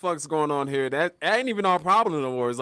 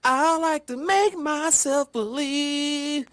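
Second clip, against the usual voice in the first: higher, very slow, drawn out; dreamy DJ; 9 semitones higher, much slower than usual. Retold or imitated. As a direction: croon it like a zoned-out DJ. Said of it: "the D J just sitting there like".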